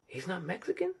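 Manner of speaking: high, soft, rising disbelief; softly baffled, voice lifted high; puzzled question. Retold or imitated. Acts out his puzzled thought.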